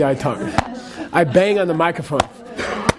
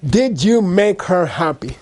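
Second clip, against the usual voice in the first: ordinary talk, nothing marked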